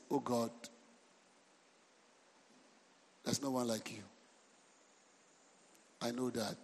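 very soft, low, dutiful praise; mock pious prayer. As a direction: murmur a pious formula to God. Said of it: "if you just talk spiritual with God".

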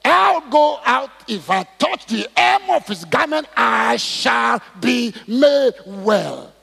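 loud, high, slow proclamation; preacher crying out a woman's declaration of faith; roused determination. Retold or imitated. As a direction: stretch out each word in a resolute shout. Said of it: "when I get up like that".

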